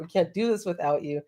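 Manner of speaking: ordinary talk, nothing marked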